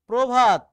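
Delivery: প্রভাত very loud, said "pro-bhat" in one go; o-kar demonstration. Shown as a demonstration. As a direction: belt out প্রভাত with a clear o after pr. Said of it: "একটা ওকার দিয়ে উচ্চারণ করো".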